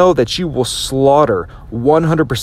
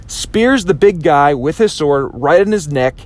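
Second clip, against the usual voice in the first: ordinary delivery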